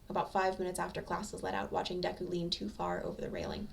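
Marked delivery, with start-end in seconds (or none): none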